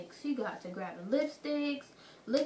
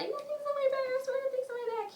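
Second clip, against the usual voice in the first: much higher, pleading mock voice; friend's panicked denial; 13 semitones higher than usual. Friend denying theft to the store guard, high and pleading. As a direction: squeak out a desperate denial.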